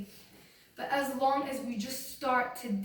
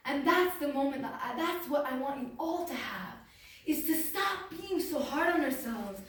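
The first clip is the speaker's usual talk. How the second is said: ordinary delivery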